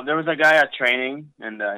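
ordinary talk

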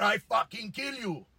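loud, high mock Italian threat; Italian accent. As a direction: shout it like an offended Italian engine builder. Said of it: "Italian guy with quite a thick accent".

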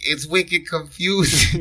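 high, slow, drawn-out Boston "wicked"; mock Boston accent. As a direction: lean into a jokey Boston accent. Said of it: "That's a Bostonian".

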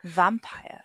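breathy seductive whisper of Vampire. Shown as a demonstration. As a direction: purr the word Vampire seductively. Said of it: "Say it in a very seductive voice".

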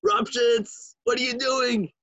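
high called-out name; mock scream. Rebbe Elimelech calling out to Rupshitz, high mock scream. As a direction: call him out in a high voice. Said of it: "he would just scream".

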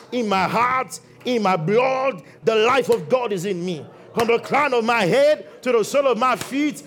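loud rising call-and-response declaration; full-force confession of health; preaching declaration. Acted. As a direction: proclaim it for the church to repeat. Congregation declaration led loudly.

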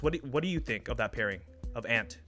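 usual speaking voice

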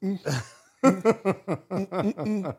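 giggly, eavesdropping hums; nosy giggling. Retold or imitated. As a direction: hum along nosily, laughing. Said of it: "I'm like".

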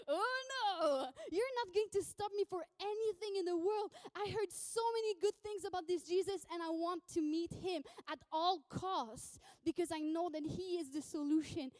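impassioned protest that starts high and settles; acted desperate determination; defiant, desperate plea. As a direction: refuse to be hushed, pleading with everything you have. Beggar refuses to be silenced.

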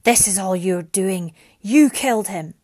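loud, hard-hit accusation; angry accusation. A narrator's reading. Bereaved father blames Mariana, loud and angry. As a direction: hurl the blame at her loudly. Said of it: "an angry voice reverberated".

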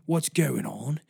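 ordinary talk

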